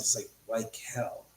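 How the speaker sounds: soft unvoiced whisper, half to himself; whispered aside. Real, not acted. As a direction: breathe it out under his breath. Muttered aside about starving.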